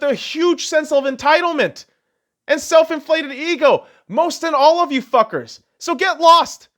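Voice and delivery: high-pitched, indignant tirade; angry rant. Real, not acted. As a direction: tear into them, exasperated. Angry rant at politicised athletes.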